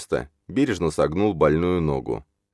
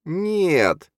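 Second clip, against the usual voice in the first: high-pitched long wail of a word; high, drawn-out character cry; drawn-out protest; 13 semitones higher than usual. A narrator's reading. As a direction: stretch it out in a high acted voice. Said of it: "и протянула".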